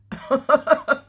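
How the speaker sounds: a sudden high burst of laughter; bright laugh. Real, not acted. Laughing at herself over the lost two hours. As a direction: laugh it off at your own mistake.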